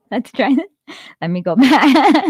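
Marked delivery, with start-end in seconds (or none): none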